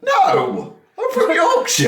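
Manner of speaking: very high, laughing protest; exasperated squeaky comeback to the kids; mock indignant reply. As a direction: squeal the denial through laughter. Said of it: "It was like".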